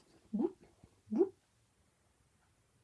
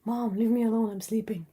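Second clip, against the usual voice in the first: sleepy cat voice; 7 dB louder than usual. Retold or imitated. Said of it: "She's like".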